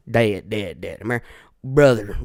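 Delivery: ordinary talk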